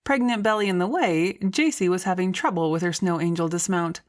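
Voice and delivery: usual speaking voice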